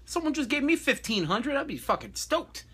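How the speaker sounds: high, incredulous rant to himself; mock-shocked inner voice; inner outburst, high-pitched. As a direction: squeak out the disbelief. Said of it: "And I'm like".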